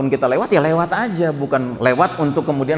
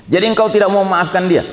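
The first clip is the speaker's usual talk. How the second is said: louder and quicker, pressing for an answer; pressing question; 6 semitones higher, 5 dB louder, faster than usual. Acted. God pressing the servant to forgive, louder and quicker. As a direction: push the question hard and fast.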